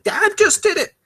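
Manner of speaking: put-on child pointing out that dad did it too; child's retort. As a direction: squeak it like a kid copying dad. Child's voice justifying bad behaviour.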